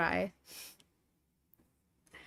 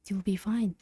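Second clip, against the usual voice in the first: ordinary talk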